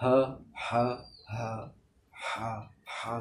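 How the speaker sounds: হা with throat pressed inward. Shown as a demonstration. Teacher drilling the pressed throat ha. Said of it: "ভেতরের দিকে চাপবেন".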